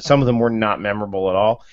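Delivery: ordinary talk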